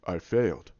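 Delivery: low, subdued admission, imitated; Thor. Thor admitting defeat to Banner, low acted voice. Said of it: "you see Thor just going".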